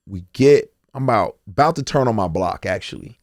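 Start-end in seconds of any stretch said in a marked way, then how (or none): none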